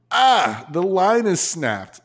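high, slow gasp of alarm at the snapped line; retold panic. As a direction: replay the moment of panic, drawn out.

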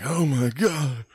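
retelling the shock at seeing mom's truck; shocked gasp. Retold or imitated. Sees mom's truck, heart drops.

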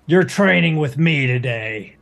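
gruff, firm scolding; stern Bacho. Acted.

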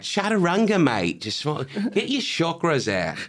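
high-pitched mock Aussie yoga hippie; Australian accent. Comic imitating Australians at free yoga, high Australian accent. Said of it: "a fucking hot Australian".

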